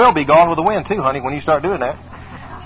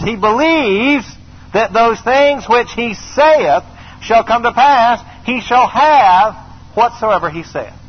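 slow emphatic preaching; 4 semitones higher, much slower than usual. Acted. Preacher hammering the verse home to the church. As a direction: drive the promise home word by word.

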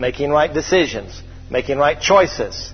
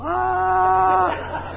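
falling yell. Retold or imitated. Man falling from top deck.

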